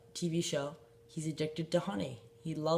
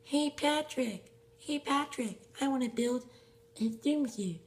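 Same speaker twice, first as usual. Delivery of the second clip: SpongeBob voice; 8 semitones higher than usual. Pretend. SpongeBob calls to Patrick, high cartoon voice. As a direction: play a high cartoon sponge calling his friend. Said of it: "And then Patrick goes like".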